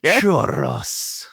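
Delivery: Churros with a trilled R; rolled R. Retold or imitated. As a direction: roll the R in Churros strongly. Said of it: "He rolled those R's".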